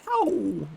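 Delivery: weird high "ow" yelp; high, falling mock-scream howl; super high-pitched failed scream. Shown as a demonstration. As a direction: attempt a scream that comes out squeaky-high. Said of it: "like, super high-pitched".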